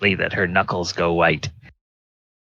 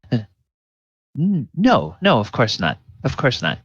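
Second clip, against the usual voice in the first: flustered denial; 3 semitones higher than usual. Acted. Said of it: "she seems kind of flustered".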